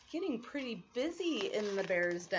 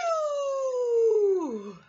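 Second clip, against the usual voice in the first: loud high hammed-up achoo; a huge cartoon sneeze; big sneeze; 11 semitones higher, 6 dB louder than usual. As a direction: let the sneeze burst out. Bear sneezes.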